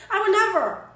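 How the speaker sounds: loud and high in offended disbelief; indignant protest. Real, not acted. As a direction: insist you'd never. Offended denial to chat.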